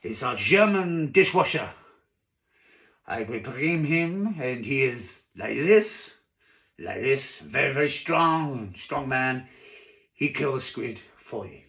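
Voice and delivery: slow, emphatic French waiter; drawn-out French accent, starting loud. Acted. Waiter recommends the dishwasher to diner, slow French accent. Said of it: "I can't do a French accent".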